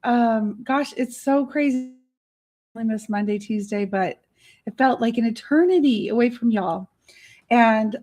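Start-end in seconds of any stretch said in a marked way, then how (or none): none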